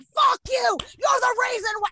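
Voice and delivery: high-pitched furious yell; retold screaming. Woman re-enacting screaming at a stranger in Target, high furious yell. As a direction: scream the blame at him, re-enacted. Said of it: "And I'm screaming in a Target".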